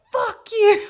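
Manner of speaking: loud, high yell of an offended man; mock-furious shout at gigglers; retold angry outburst. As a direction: imitate a stranger lashing out loudly. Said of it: "he turned around and was like".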